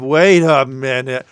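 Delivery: loud, slow, drawn-out interruption; mock sudden realisation. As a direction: halt everything with a big acted double take. Acted sudden double take, first take.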